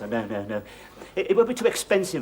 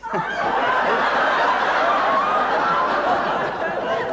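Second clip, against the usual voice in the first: burst of laughter. Real, not acted. Caught searching for the wallet.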